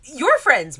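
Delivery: first eager step before the big cry; excited realization. Acted.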